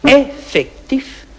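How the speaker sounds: drawn-out effectif. Acted. Farmer hearing footsteps, drawn-out "effectif". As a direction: stretch "effectif" very slowly, holding each syllable. Tense listening, footsteps outside.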